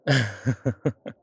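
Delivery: amused chuckle. Acted. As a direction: let out a quick run of chuckles. Vampire amused by grim aside.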